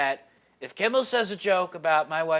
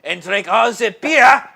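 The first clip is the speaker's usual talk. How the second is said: loud mock German accent; 6 dB louder than usual. Co-host tells Larry's beer hall story, mock German accent. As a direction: tell it as a hammed-up German.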